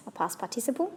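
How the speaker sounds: normal talking voice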